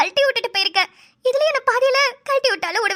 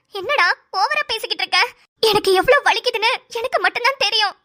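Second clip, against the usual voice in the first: usual speaking voice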